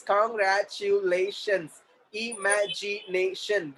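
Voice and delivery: very slow, separated syllables. Shown as a demonstration. Teacher modelling syllables for the class, syllable by syllable. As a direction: break the word into slow beats.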